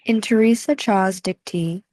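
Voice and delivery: ordinary talk, nothing marked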